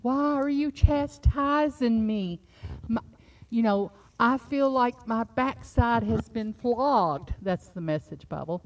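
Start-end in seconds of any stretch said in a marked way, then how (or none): 0.0-7.3 slow, pleading yell in David's voice; mock yell at God. Preacher voicing David crying out to God. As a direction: lament it loudly, dragging the words. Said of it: "he would yell at God".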